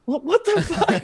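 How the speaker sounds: very high comic squeak of alarm; panicked disbelief. Retold or imitated. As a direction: blurt it in mock panic. Acts his panic at the call.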